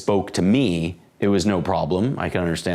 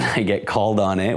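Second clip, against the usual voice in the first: ordinary delivery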